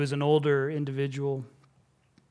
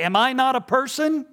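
put-on indignant little girl; 11 semitones higher, 9 dB louder than usual. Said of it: "She looked up at him as if to say".

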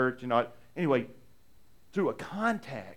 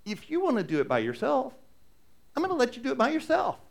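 voicing the caddy's meaning; 8 semitones higher than usual. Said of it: "what he's saying is".

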